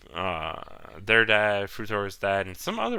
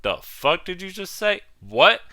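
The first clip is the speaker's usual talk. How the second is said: shocked outburst; 9 semitones higher, 7 dB louder than usual. Retold or imitated. Retold shock at twist reveal.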